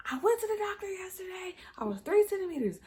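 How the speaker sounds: high-pitched, strained retort, retold; exasperated answer. Woman in labour to hospital staff, acted exasperation. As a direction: answer high, fed up with being questioned.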